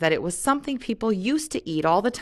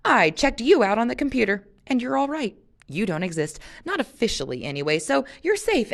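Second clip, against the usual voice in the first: bouncy; 6 semitones higher, 3 dB louder than usual. A narrator's reading. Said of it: "with a bounce that propelled her".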